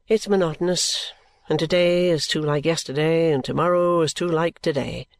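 low character voice, mild complaint; bored Richard. Acted. Richard explaining his dislike of the work, bored. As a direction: keep it low and monotonous.

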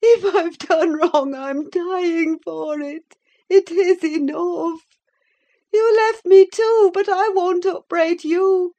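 put-on high, yearning young woman voice; feverish young woman. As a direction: plead it like a dying heroine. Catherine voiced in read novel.

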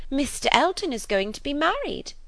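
high, loud breaking of news; gushing, triumphant news-telling; excited announcement. A narrator's reading. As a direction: burst out with the big gossip. Gossip bursts out her news.

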